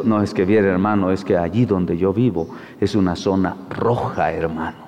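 low, mumbled mock complaint; imitating a fearful brother. Preacher mimics church members excusing themselves, in a low mock voice. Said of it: "Así dicen".

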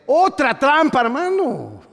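high, loud cry of "otra trampa"; loud rebuke; exclaiming. Real, not acted. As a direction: call it out like catching someone cheating. Preacher exclaims at the congregation.

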